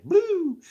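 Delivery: high loud whoop; excited whoop. Real, not acted. Speaker whooping at his own riff, loud and high. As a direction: let out a big high whoop.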